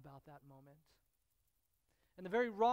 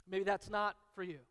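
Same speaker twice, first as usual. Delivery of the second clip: ordinary talk, nothing marked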